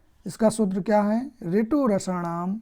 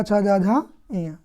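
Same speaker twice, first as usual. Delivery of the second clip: usual speaking voice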